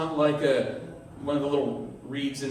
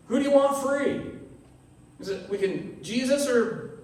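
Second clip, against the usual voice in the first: calling out to crowd; 7 semitones higher than usual. Retold or imitated. Pilate offering a prisoner to the crowd.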